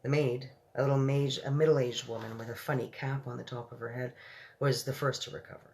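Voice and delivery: ordinary delivery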